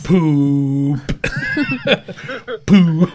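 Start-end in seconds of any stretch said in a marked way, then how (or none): 1.0-3.1 giggling at his own silly joke; corny dad laugh. Real, not acted. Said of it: "That's such a dad joke".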